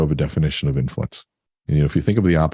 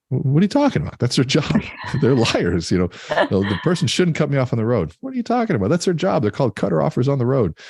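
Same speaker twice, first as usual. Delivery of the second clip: high-pitched comic disbelief; mock incredulity; 9 semitones higher than usual. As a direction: scoff playfully at a naive complaint. Mocks naive complaints, quoting Jim Rohn.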